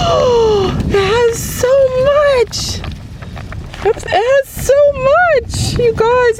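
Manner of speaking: high, slow, thrilled squealing; excited squeal. Real, not acted. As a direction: squeal it out in delight. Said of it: "I'm so excited".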